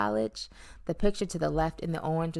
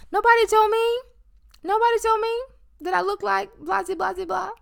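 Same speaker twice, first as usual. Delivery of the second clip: mock squeal; 7 dB louder than usual. Exaggerated squeal about family silence.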